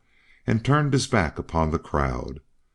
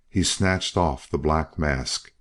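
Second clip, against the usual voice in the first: ordinary delivery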